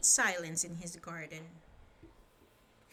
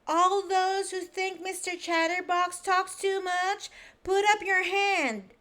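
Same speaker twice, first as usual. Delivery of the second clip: high, loud commanding voice; bossy order; 10 semitones higher, 8 dB louder than usual. Acted. Little Miss Bossy ordering the meeting, loud and high. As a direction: bark the order at the room. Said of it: "ordered Little Miss Bossy".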